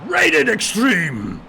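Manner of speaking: very loud, high, stretched-out yell; shouted catchphrase. Pretend. Co-host defends his catchphrase by shouting it. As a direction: bellow it like a hype announcer. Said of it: "what's wrong with shouting".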